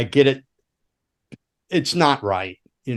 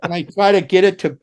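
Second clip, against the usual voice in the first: ordinary delivery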